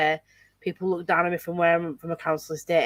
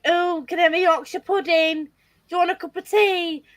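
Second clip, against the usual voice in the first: high, chirpy mimic of curious foreigners; mock foreigner voice; 10 semitones higher than usual. Mimicking people abroad asking about Yorkshire, high mock voice. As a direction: poke fun at tourists' clichés, pitched up. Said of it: "Whenever I meet people abroad".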